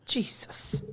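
breathy pained whisper. Real, not acted. Cohost hurting herself mid-talk, whispering in pain. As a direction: hiss it after bumping yourself.

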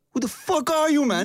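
high-pitched retold challenge in English; Michael Bisping. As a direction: imitate an affronted fighter confronting an intruder. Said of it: "Opeens Michael Bisping, die kijkt naar mij".

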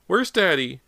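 put-on high insistent child voice; demanding child. A narrator's reading. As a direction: demand like a scared boy. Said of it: "James demanded".

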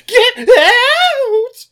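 very high, drawn-out belted scream; mock screamed order. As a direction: belt it out like a rock-musical climax. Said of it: "tells Judas to".

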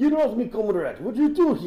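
high, loud mock-Russian protest; hammed-up Russian comrade. Assassin grappling with Rasputin, hammed-up Russian comrade. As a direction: squawk it like a panicked comic comrade.

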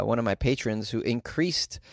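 ordinary talk

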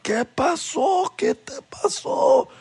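high, slow, crying mom voice; frantic mother shrieking in Spanish; panicked mom. Retold or imitated. Mom sees her bleeding son, high panicked cry. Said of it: "my mom freaking out".

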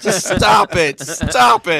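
laughing, pleading to make it stop; laughing plea. Real, not acted. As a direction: beg through laughter. Begging them to stop, laughing.

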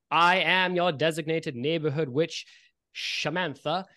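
stately witch host, projecting; Shamantha. Acted. Witch introduces herself to the festival crowd, projected announcer voice. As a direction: announce yourself slowly and grandly to a crowd. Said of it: "dressed up witch hops up".

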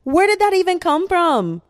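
loud, high shriek of disbelief; high-pitched incredulous yell; exasperated shout. Real, not acted. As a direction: shout it in baffled outrage. Outburst at own tangent.

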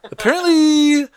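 put-on high squeaky kid voice saying Apparently, slowed. Host mocking his own habit with a kid's Apparently. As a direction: pitch Apparently way up like a whiny kid. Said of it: "I'm turned into the apparently kid".

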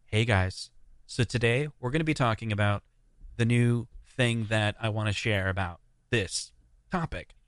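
laid-back early-days intro, in no rush; old unhurried intro. Retold or imitated. Early YouTuber imitating his old intro, unhurried. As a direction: ease through the intro like a beginner. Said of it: "the content was kinda like".

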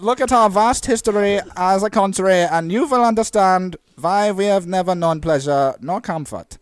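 high, loud, strained German lament; mock German accent. Mock German explaining his country's history, strained high accent. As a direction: declaim it in a squeezed German accent. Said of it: "Germans always sound like".

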